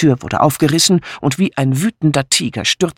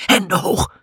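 husky male hold-up voice, first command; hoarse snapped order; 5 semitones higher than usual. A narrator's reading. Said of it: "eine heisere Männerstimme ... schnauzte".

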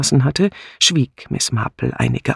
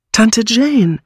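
urgent, high-pitched, louder call; anxious call; 10 semitones higher, 6 dB louder than usual. A narrator's reading. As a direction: call her out of her daze, worried. Bunch calls her dazed aunt anxiously. (besorgt und eindringlich gerufen)

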